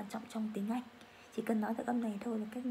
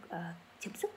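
normal talking voice

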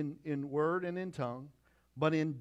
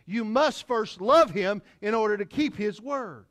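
loud, high insistent preaching; full-voiced pulpit preaching; preaching at full force; 9 semitones higher, 12 dB louder than usual. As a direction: drive the point home to the congregation. Loud preaching on love and obedience.

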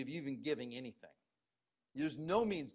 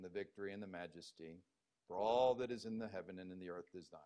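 droning flat reading; 10 semitones lower, 6 dB quieter than usual. Shown as a demonstration. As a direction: recite it like a bored ritual. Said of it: "do you think David said it like this?".

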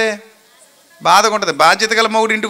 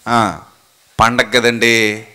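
meek excuse; 10 semitones lower, much slower than usual. Retold or imitated. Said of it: "అంటాడు".

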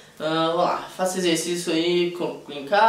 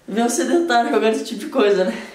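ordinary talk

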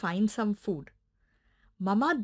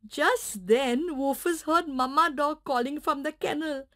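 ordinary delivery

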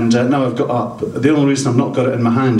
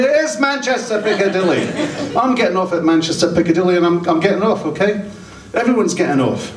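mock exasperated; 8 semitones higher, faster than usual. Exasperated comedian snaps back.

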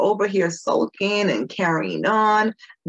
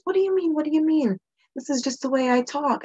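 indignant surprise; 8 semitones higher than usual. Retold or imitated. Her own past defensive reply.

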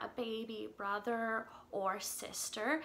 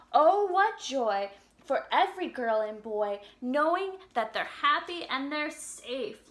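put-on reading to children; 6 dB louder than usual. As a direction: read the lyrics gently to little kids. Reads song lyrics to children.

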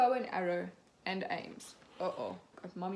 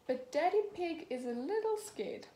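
ordinary delivery

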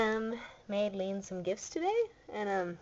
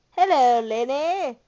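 loud, higher singsong hello; calling out greeting; 4 semitones higher, 12 dB louder, slower than usual. Real, not acted. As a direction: call out hello to get attention. Calling out to Lane.

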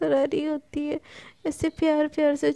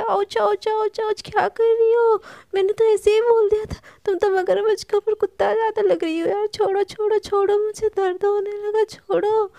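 high, louder acted yelping and pleading; pained protest; 6 semitones higher, 5 dB louder than usual. Palak being bitten by her friend, pained pleading. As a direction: beg to be let go, as if it hurts. Acted bite, begging to be released.

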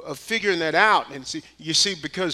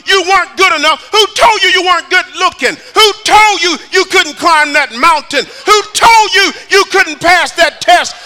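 high, loud, shouting sermon climax; preaching at full force; 11 semitones higher, 12 dB louder than usual. Acted. Preacher urging the church to run to God, shouting. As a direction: drive it home to the congregation.

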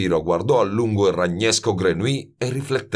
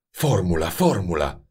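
croaking. A narrator's reading. Grenouille to Baldini from the door, croaking. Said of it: "gracchiò Grenouille".

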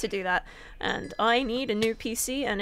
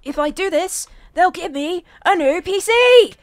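building shout; 6 semitones higher, 10 dB louder than usual. Real, not acted. Repeating the line, building.

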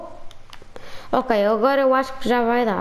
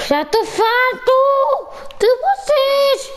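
fed-up whine; 9 semitones higher than usual. Real, not acted. Fed up with the fish.

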